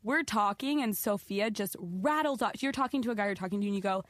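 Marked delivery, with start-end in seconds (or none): none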